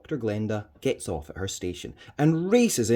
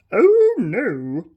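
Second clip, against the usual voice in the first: a hint of a fancy whispered voice; lightly done fancy voice; 12 semitones higher, 11 dB louder than usual. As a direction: put on a posh disguise voice. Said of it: "in a fancy voice".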